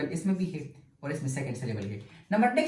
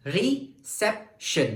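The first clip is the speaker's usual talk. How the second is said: "reception" slowly counted out in three beats; syllable by syllable; 3 semitones higher, much slower than usual. Shown as a demonstration. Teacher repeating for the class, "reception" split into separate syllables. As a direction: pause between re, cep and tion in "reception". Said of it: "let's pronunciate each syllable separately".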